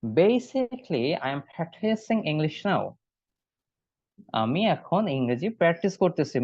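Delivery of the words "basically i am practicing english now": "basically" as bay-sick-lee, then the sentence very slowly; slow model reading. Shown as a demonstration. Teacher models the answer line slowly for the class. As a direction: say "basically" with a bay start and read the rest slowly. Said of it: "উচ্চারণটা খেয়াল করবেন সবাই".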